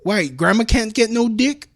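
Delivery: high-pitched comic indignation; hammed-up objection on grandma's behalf; mock protest. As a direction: protest in a high, loud, incredulous voice.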